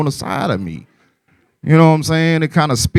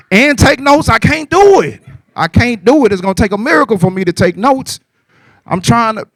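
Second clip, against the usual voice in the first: high, strained mock pleading; squeaky put-upon complaint about taking notes; pleading to security; 8 semitones higher than usual. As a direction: whine it up high like you can't manage. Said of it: "I'm looking at them like".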